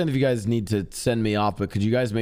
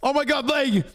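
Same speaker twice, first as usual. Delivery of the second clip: panicked yell. Acted. Same cry repeated.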